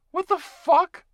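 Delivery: sudden high, loud shocked swear; shocked outburst. Acted. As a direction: blurt it out in disbelief. Shocked at being a werewolf.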